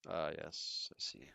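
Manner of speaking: breathy hushed whisper, dropping low at the end. Real, not acted. Streamer whispering a reply to chat. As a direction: hush it like a secret aside.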